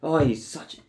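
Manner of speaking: usual speaking voice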